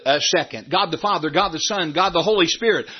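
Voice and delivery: usual speaking voice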